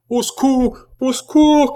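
high, loud, desperate scream; distraught full-voiced shriek; frantic cry for help. A narrator's reading. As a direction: cry out wildly for rescue. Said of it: "cria Jeanne éperdue".

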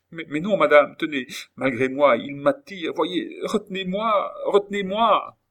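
high, urgent cry for help; acted terror, voice pushed up; panicked pleading. A narrator's reading. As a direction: beg desperately, climbing to a shrill peak. Terrified woman begs to be held.

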